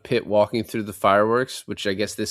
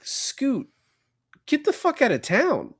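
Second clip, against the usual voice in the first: much higher, squeaky mock voice; mock squeaky hitman voice; slower than usual. Podcaster paraphrasing the hitman's warning to Scoot, in a squeaky mock voice. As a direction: squeak out the threat like a cartoon.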